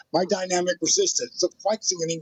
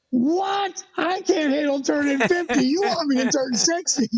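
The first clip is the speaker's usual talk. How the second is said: much higher, mock-shocked squeal of disbelief; shocked outburst; 9 semitones higher than usual. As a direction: play the client aghast at the idea of sixty. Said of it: "she went off".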